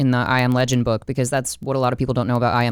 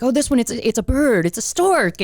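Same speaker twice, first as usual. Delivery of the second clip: high-pitched, eager guessing; acted-out puzzled reader, pitched well up; excited imitation of a character; 9 semitones higher than usual. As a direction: blurt out guesses in a raised, playful mock voice. Acting out Jonathan misreading hieroglyphics.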